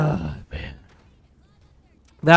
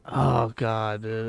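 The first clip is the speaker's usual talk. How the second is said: ordinary talk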